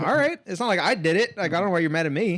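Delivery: defensive. Retold or imitated. Customer protesting to the upset store clerk, defensive. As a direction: mildly protest your innocence.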